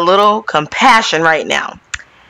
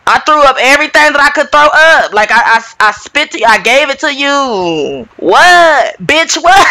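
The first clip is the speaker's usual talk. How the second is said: exasperated Kathy; 6 semitones higher than usual. Retold or imitated. Voicing Kathy fed up after crying.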